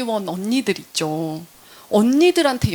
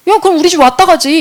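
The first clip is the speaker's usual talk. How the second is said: a loud, breezy, higher "come on over"; loud offhand invite; 6 semitones higher, 8 dB louder than usual. Retold or imitated. The quirky postpartum unni inviting her over, loud and breezy. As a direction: toss the invitation out loudly and casually.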